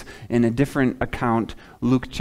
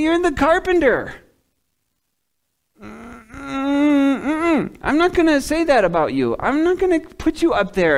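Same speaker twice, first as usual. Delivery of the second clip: much higher, sneering mock of a proud local; scornful townsman; 12 semitones higher than usual. Proud Nazareth local dismissing Jesus, high sneering scorn. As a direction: look down your nose at the carpenter's son.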